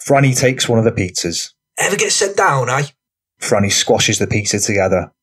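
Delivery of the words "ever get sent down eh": rough lad voice. A narrator's reading. Franny character voice.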